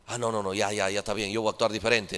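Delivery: low, soft mumbled concession; meek backing down. Acted. Preacher acting out a people-pleaser changing course, meek backing down. Acts out someone caving to opinion.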